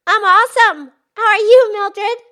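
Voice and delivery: bright, loud cheerful hello; excited greeting. Acted. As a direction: greet your friend with big enthusiasm.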